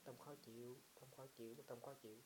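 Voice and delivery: note it gently, again and again; meditation noting. Shown as a demonstration. Monk demonstrates mindful noting to the student, softly. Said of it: "duy trì chánh niệm".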